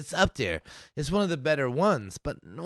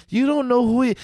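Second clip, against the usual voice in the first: usual speaking voice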